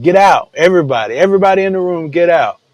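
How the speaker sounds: mock commanding call to everyone; acted urgent order. Joseph ordering everybody out of the room, urgent command.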